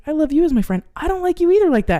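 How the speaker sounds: quick, emphatic me-too reply, acted out; retold eager agreement. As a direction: bounce it back, rising in pitch. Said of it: "And I was like".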